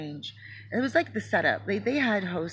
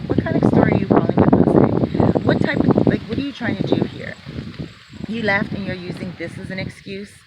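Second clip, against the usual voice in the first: louder, harsh accusing questions; angry accusation; 12 dB louder than usual. Retold or imitated. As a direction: tear into him with suspicion. Sister angrily accusing.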